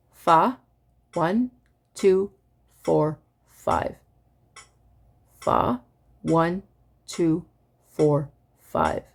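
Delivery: evenly spaced counting, one word per click; metronome recitation. Shown as a demonstration. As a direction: chant the pitch and dot numbers in strict time. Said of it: "So this is how it sounds".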